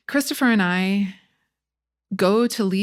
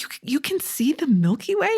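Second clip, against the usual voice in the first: high-pitched incredulous question; acted-out astonishment, rising at the end; retold amazement; 8 semitones higher than usual. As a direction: replay your wide-eyed wonder. Retold astonishment at the night sky.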